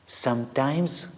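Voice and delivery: plain reading voice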